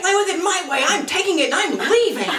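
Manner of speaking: high, whiny tantrum imitation; bratty child. Mimicking her children fighting over a toy, bratty tantrum. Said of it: "like, my own children".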